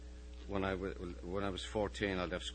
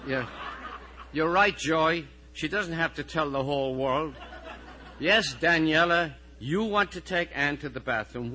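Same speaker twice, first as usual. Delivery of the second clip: raised, higher voice fielding interruptions; weary classroom authority, pushed louder; exasperated teacher; 8 semitones higher, 11 dB louder than usual. Acted. Teacher dealing with sick and squabbling pupils, exasperated.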